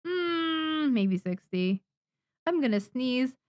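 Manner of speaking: ordinary talk, nothing marked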